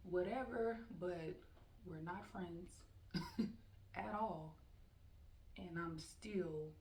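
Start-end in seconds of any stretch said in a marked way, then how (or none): 2.7-3.9 a quick unvoiced high giggle; breathy laugh. Real, not acted. Teacher laughing at we're not friends, giggle. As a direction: let a short laugh slip out.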